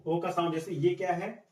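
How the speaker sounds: normal talking voice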